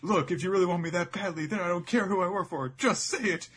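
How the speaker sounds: high, loud pleading climbing to falsetto; hammed-up smitten heroine; lovestruck Baby Five. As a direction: build to a desperate high demand. Baby Five's lovestruck outburst, she's blushing.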